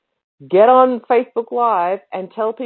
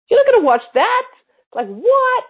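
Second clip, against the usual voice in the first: mock baffled viewer; 4 dB louder than usual. Mock viewer reaction to boring video.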